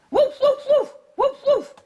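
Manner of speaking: high yappy woof; dog barking. Acted. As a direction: bark like a small excited dog. Dog barks in story.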